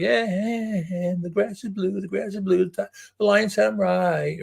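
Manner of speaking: high taunting chant; gloating monkey. Acted. Monkey running off after winning, taunting.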